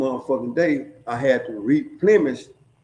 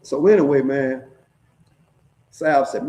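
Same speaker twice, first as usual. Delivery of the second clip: ordinary talk, nothing marked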